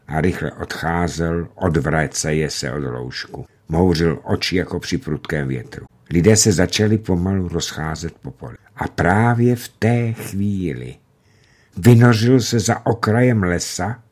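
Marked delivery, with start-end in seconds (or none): none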